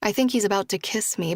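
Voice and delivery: plain reading voice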